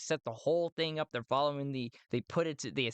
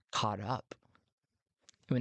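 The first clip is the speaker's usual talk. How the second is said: ordinary delivery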